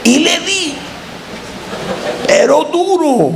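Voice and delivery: high, drawn-out storyteller's cry; emphatic exclamation. Real, not acted. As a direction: relive the blow with a high cry. Reliving the fight, cried out. (exclamación aguda y enfática)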